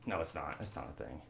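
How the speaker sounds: ordinary delivery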